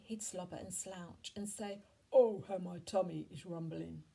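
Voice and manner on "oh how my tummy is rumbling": grumpy giant, loud groan of hunger; loud grumbling giant. A narrator's reading. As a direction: complain loudly like a hungry old grouch. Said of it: "was a grumpy old grouch".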